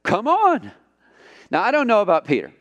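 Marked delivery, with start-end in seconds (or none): 0.0-0.7 high, light call to come; Jesus inviting. Acted. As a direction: beckon him onto the water, high and easy. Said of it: "And Jesus said".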